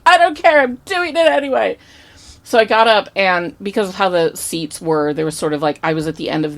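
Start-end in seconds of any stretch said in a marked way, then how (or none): 0.1-1.8 high, loud burst of stubborn excitement; defiant outburst. Retold or imitated. Telling friends she'll approach the star anyway, defiant. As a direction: blurt it out higher and louder, imitating your own defiance.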